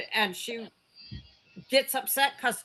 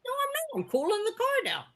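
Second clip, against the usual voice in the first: mock indignant reply; 13 semitones higher than usual. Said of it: "I'm like".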